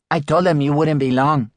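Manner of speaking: voiced Ying. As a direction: play the cheerful young shop assistant. Ying's line in dialogue.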